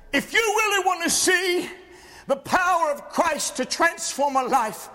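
high, ringing sermon delivery; emphatic pulpit declaration; fiery preaching. Real, not acted. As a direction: lift the voice and proclaim it. Preaches at full force.

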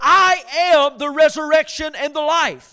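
loud, ringing proclamation of the verse; preached verse. Acted. Pastor proclaiming the verse to his congregation, loud. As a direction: proclaim it loudly to the room.

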